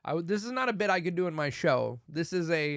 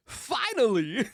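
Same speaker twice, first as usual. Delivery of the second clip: high, loud, stretched exclamation; badly faked celebrity impression, drawn out; Christopher Walken; 8 semitones higher, 5 dB louder, slower than usual. Movie star cutting into the song, poor impression. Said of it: "I cannot do a Christopher Walken voice".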